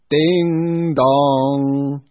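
long ringing ding dong like a church bell; bell chime. A narrator's reading. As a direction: chime it out loud and slow.

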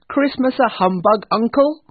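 high, loud disbelief; cheerful nephew. Acted. Nephew questioning his uncle's scorn, incredulous. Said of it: "said Scrooge's nephew".

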